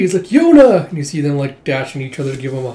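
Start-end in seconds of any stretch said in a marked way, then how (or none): 0.2-0.9 high, loud joyful call of a friend's name; thrilled reunion shout; excited cry. Retold or imitated. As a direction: call out Riri delightedly across the room. Reunion, calling back friend's name.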